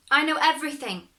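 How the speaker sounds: high, quick proud boast; cocky young hero brags to the watchman; boastful. Acted. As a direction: toss it off with pride.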